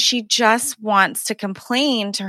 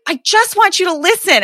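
much higher, louder plea to be heard; pleading wife; 10 semitones higher, 4 dB louder than usual. Retold or imitated. Wife begs the partner to only listen, high and pleading. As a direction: beg him to just listen.

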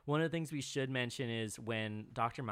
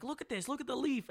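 imitating a freak-out; 12 semitones higher than usual. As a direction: act out someone freaking out over a find, pitched way up. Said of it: "freaking out over a".

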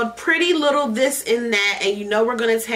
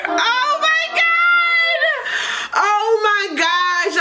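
much higher, very slow, louder squeal of surprise; delighted shriek; 3 dB louder, much slower than usual. Real, not acted. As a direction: squeal at the unexpected lighter. Finding the surprise lighter.

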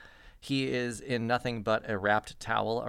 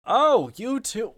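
high, slow startled greeting; surprised prince; 8 semitones higher than usual. Acted. Prince startled at finding Edith and Humphrey, high surprised greeting. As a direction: caught off guard, pitch jumps up.

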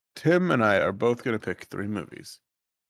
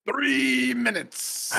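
mock drawn-out taunt; 14 semitones higher, much slower than usual.

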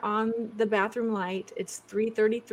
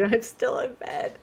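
whispered sleepy imitation; imitating groggy whisper; 3 semitones higher, slower than usual. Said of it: "There's my groggy".

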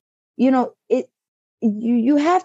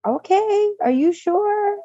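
higher-pitched, questioning daughter's voice; doubtful checking; 10 semitones higher, slower than usual. Retold or imitated. Retold daughter checking on mom.

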